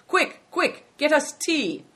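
high, loud call up to someone; urgent shout. A narrator's reading. Driver shouting up to innkeeper for tea, urgent shout. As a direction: shout a hurried order, pitched high. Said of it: "Good Fortune shouted up to him".

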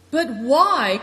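loud, high exclamation; crying out. A narrator's reading. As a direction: throw the question at them. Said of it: "cried Good Fortune".

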